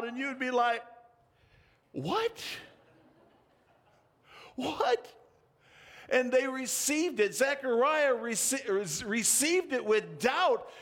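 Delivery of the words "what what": high, stunned What?; shocked disbelief. Retold or imitated. Old couple hearing of a baby, stunned and high. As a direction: gasp it out in disbelief.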